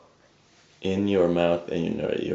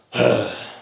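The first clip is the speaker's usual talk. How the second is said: deeper, raspy sigh; 4 semitones higher than usual. Shown as a demonstration. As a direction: sigh deeply with a rasp in it. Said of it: "and then a deeper sigh".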